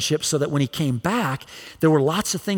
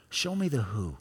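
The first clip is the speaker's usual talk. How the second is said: "Show me the who" dropped much softer, hushed; soft prayer; 4 semitones lower, 10 dB quieter than usual. Acted. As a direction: pull back into a soft inward prayer. Prayer quoted, repeated softer.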